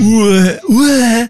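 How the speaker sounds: loud, high-pitched mock call; playful exaggerated pronunciation. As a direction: throw the name out high and loud, hammed-up. Playfully trying out the company name.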